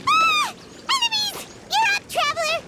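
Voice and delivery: high, startled shout of alarm; high-pitched panicked callout; alarmed cry. Acted. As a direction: sound the alarm the moment enemies appear. Paimon spots enemies, alerts traveler.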